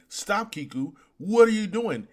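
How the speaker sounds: lightly voiced impostor crying out; Odin. Impostor telling Kiku to stop, lightly acted startled protest. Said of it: "In Odin's voice".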